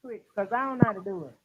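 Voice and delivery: faint, distant phone voice; barely audible. Real, not acted. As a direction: caller far from the mic. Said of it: "but you sound very faint".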